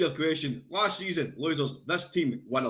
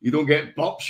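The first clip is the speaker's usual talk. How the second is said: ordinary delivery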